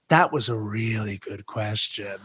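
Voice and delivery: dry understated compliment; Molly Shannon quietly praising the question, deadpan; imitating Molly Shannon.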